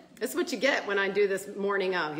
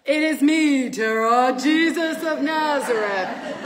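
louder, slower, grand mock Jesus; playful divine voice; 5 semitones higher, 7 dB louder, slower than usual. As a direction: announce yourself slowly and grandly. Playfully voicing Jesus to her.

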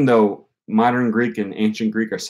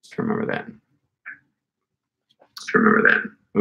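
whispered to self. Real, not acted. Muttering while writing a note.

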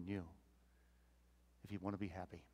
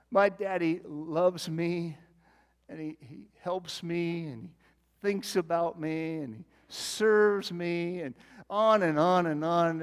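fervent quote of daughter; 5 semitones higher, 11 dB louder, slower than usual. Retold or imitated.

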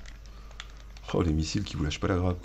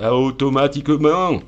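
loud, high, slow mock German accent; 6 semitones higher, 7 dB louder, slower than usual. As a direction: put on an emphatic German accent, stretched out. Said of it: "avec l'accent allemand". (accent allemand forcé, fort et appuyé)

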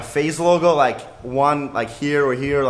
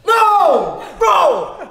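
two high, loud cries; shocked protest; 12 semitones higher, 4 dB louder than usual. Real, not acted. Host protesting the spin, high shouted dismay. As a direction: shout each word high in disbelief.

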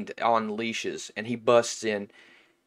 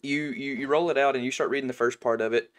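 ordinary talk

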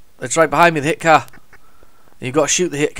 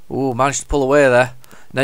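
ordinary talk, nothing marked

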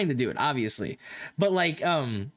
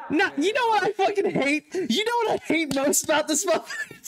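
high-pitched pretend outrage; playful fake fury; mock hate; 11 semitones higher than usual. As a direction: rant in joking exasperation, pitched way up. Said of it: "You know I'm kidding".

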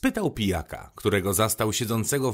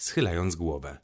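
ordinary delivery, nothing marked

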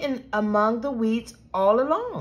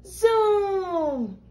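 high, long drawn-out zoom; imitated truck zoom; 7 semitones higher than usual. A narrator's reading. Peter driving his truck through the garden, playful zoom. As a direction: stretch the vowel high and let it slide down.

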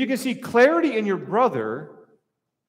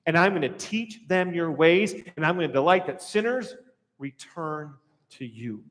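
ordinary talk

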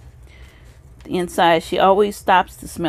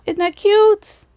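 excited gush; 13 semitones higher, 3 dB louder, slower than usual. Real, not acted. Showing a friend's handmade card, thrilled and much higher. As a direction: voice jumps way up in delight.

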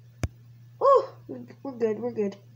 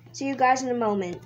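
ordinary delivery

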